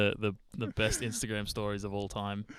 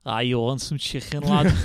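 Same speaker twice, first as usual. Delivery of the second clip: mock Scouse accent, louder on lad; Fabinho's Scouse; 3 semitones higher, 7 dB louder than usual. Joking as an example of British accents, mock Scouse. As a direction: put on a thick Scouse accent. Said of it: "Fabinho's impression of a Scouse".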